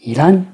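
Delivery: "Iran" as a careful Japanese word, before any suffix; base word accent demo. Shown as a demonstration. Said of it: "toujours avec l'Iran".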